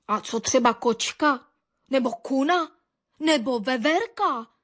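separate, pointed questions; frowning challenge. A narrator's reading. Baron the dog doubts the birds' accusation, frowning questions. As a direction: challenge them with each suggestion. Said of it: "zamračil se baron".

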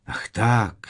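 high sigh of understanding; relieved. A narrator's reading. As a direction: let relief burst out. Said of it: "málem se úlevou rozesmál".

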